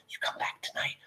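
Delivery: hushed lovers' whisper; whispering daughter. Acted. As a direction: whisper it low and secret to a lover. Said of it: "she hears the daughter say".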